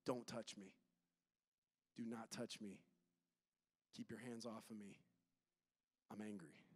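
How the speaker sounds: low, soft and cold, shutting them out; cold quiet withdrawal. Pretend. Preacher voicing an angry person wanting space, quiet and cold. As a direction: say it quietly, pulling away.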